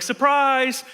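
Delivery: cheery surprise. Retold or imitated. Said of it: "He's like".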